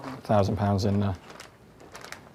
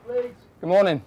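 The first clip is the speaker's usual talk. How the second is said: calling out; 9 semitones higher than usual. Real, not acted. Calling into house during raid.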